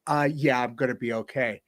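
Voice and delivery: soft, deflecting "I'm okay"; a hollow, quiet brush-off; subdued reassurance. Retold or imitated. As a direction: play down the pain in a soft voice. Suicidal friend answers quietly.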